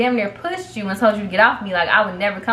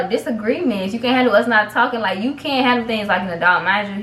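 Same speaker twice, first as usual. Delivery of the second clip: ordinary talk, nothing marked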